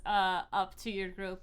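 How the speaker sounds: usual speaking voice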